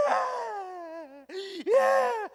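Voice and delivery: high wail rising to a loud shriek; high, drawn-out mock crying; mock baby screaming. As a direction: bawl like a baby who won't stop. Imitates baby crying harder.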